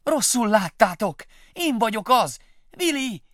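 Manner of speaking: high, loud, slow begging; pleading shout. Acted. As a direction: keep calling through the door.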